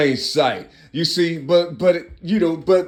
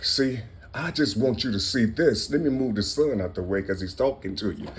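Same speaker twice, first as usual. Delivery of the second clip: ordinary talk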